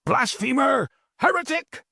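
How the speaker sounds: high roar of accusation. Acted. Hooded figures condemning the master, high roar. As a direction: hurl the words at him. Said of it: "the alien voices roared".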